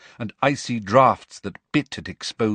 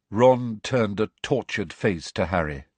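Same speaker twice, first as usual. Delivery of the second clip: usual speaking voice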